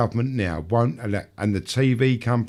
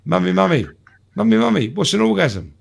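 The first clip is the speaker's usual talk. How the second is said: put-on child voice; 5 semitones higher than usual.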